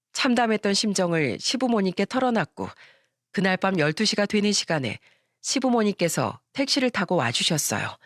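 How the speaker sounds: ordinary talk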